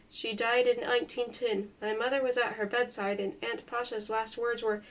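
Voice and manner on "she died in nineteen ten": ordinary delivery, nothing marked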